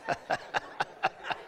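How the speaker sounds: quiet laugh. Real, not acted. Laughing at his own joke.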